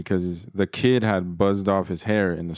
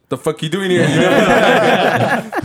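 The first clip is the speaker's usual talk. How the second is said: laughing surprise; 9 semitones higher, 7 dB louder, much slower than usual. Real, not acted.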